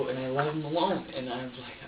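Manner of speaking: ordinary talk, nothing marked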